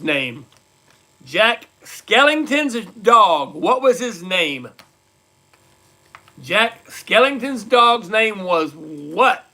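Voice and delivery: very slow, spaced-out trivia question; deliberate game-show host delivery; quizmaster question read. Real, not acted. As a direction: draw out each phrase for the players. Quiz host reads out question.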